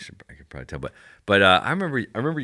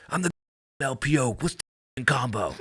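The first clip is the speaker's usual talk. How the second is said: high-pitched mock yell at a junior; retold angry scream; 6 semitones higher than usual. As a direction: imitate a boss screaming a demand. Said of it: "he screamed at me".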